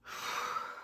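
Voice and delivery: long, soft breath out; heavy sigh. Real, not acted. Woman reflecting on her younger self, sighing. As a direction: let out a slow, weary sigh.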